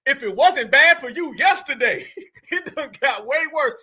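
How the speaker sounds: talking through a real laugh. Real, not acted. Host mocking the deported, laughing mid-sentence. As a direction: laugh as the words come out.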